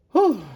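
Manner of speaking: high, loud release of breath; emotional whew. Real, not acted. Reader overwhelmed by the cards, loud emotional whew. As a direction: let the feeling out in one big whew.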